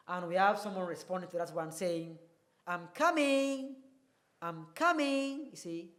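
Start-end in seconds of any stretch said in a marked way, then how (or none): 2.6-5.5 slow "I'm coming" lifted high; polite rising tune. Shown as a demonstration. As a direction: answer gently, rising on "coming".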